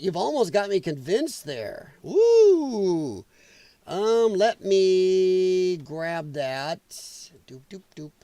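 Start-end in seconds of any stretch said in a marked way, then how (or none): 2.0-3.2 high, falling woo; astonished woo. Real, not acted. Host almost convinced by a chatter, astonished woo. 7.5-8.1 very soft little doops under the breath; idle doop noises. Real, not acted. Host busy grabbing a link, idly doop-dooping. Filler while copying a link.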